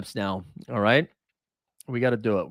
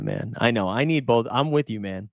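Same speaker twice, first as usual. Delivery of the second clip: ordinary talk, nothing marked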